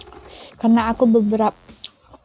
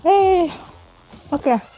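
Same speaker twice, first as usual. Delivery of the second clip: excited; 7 semitones higher than usual. Real, not acted. Finished the bowl.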